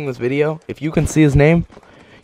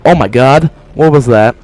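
ordinary talk, nothing marked

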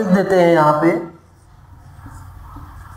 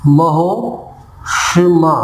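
महोष्मा stretched very slowly, every sound held; slow model word; much slower than usual. Shown as a demonstration. Teacher slowly blends महा and ऊष्मा into महोष्मा for the class. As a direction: draw out महोष्मा as if joining its parts. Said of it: "मिलाकर देख देते हैं".

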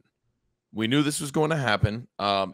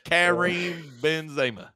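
drawled, higher country-accent names; 6 semitones higher than usual. Shown as a demonstration. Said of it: "say it with a country accent".

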